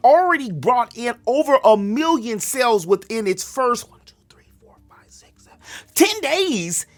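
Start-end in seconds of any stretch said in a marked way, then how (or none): 3.9-5.6 whispered count. Real, not acted. Counting days under breath.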